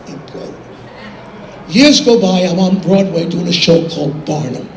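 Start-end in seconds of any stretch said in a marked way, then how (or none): none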